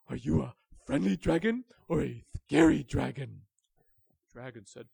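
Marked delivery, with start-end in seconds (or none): 0.1-3.4 loud, wary question; nervous wolf question. Acted. Willy asking the dragon, loud and wary. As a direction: ask it warily as Willy.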